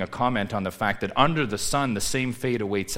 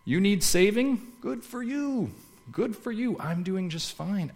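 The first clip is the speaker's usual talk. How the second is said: high, mocking brush-off of a smug unbeliever; dismissive unbeliever; 11 semitones higher than usual. Preacher mimics an unbeliever to the congregation, high and dismissive. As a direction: toss it off like a smug skeptic, higher. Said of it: "You talk to an unbeliever".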